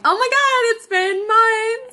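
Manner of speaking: high, loud, drawn-out mock gush; gushing greeting. Friend imitating the co-host's expected show opening, high mock gushing. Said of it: "you were gonna be like".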